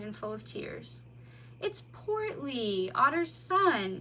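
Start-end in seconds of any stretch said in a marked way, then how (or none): 1.6-4.0 slow, hushed recognition; surprised. A narrator's reading. Mole finding the otter at the door, surprised. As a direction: realise who it is, slowly. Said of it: "said the mole in surprise".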